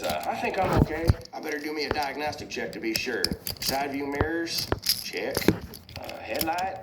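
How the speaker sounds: high-pitched cartoon truck taking stock; Mater. Zapped truck checks himself over, high character voice. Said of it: "And Mater says".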